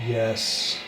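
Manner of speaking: radio tuning in. Real, not acted. Spirit box answer, faint.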